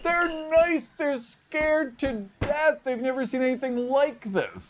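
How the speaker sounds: high, slow, exasperated pleading. Acted. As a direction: plead with a baffled friend.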